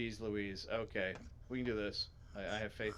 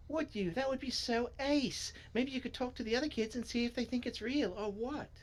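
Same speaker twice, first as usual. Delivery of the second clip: excited story character; 13 semitones higher than usual. Acted. Reader voicing a character keen to ask the other kids, high voice. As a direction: pitch the character well up high.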